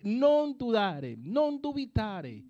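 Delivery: slow, deliberate "Non dudare, non dubitare" with each word weighed; slow emphasis. Shown as a demonstration. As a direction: lean on "Non dudare" as the key to the verse. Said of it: "Observe dónde está la clave".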